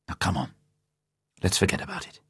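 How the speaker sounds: soft and soothing; comforting. A narrator's reading. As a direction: comfort her gently. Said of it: "said Bond comfortingly".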